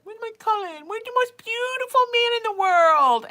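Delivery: very high, cooing pet voice; cat-calling voice. Retold or imitated. Calling her cat from another room in a high cooing voice. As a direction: coo for your cat like baby talk. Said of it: "I'll say, like".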